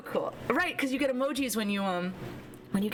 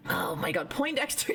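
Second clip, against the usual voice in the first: normal talking voice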